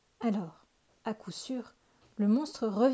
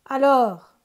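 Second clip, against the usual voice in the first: very loud, higher bellowed Alors; impatient ogre shout; 5 semitones higher, 16 dB louder than usual. A narrator's reading. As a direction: roar it through the door. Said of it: "cria l'ogre".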